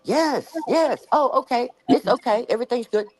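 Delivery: high, eager mock driver voice; lightly voiced cheerful driver; imitating the relieved driver. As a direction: play the driver suddenly all smiles. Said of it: "So he finally says".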